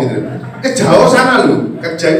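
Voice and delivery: ordinary talk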